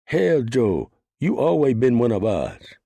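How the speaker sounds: put-on loud, high bellow with a Chinese accent. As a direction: roar it across the cell block, Chinese accent. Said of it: "bellowed ... in a thick Chinese accent".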